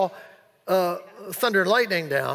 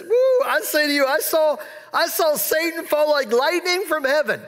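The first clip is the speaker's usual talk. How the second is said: higher, joyful telling; excited Jesus; 6 semitones higher than usual. Retold or imitated. Preacher voicing Jesus overjoyed with the returning seventy, excited. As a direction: say it with rising delight. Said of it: "this is probably how Jesus said this".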